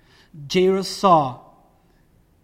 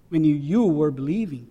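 ordinary talk, nothing marked

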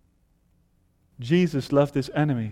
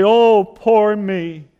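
loud, slow whining; mock self-pity; 5 semitones higher, 9 dB louder, much slower than usual. Mocking a complaining believer, slow and whiny.

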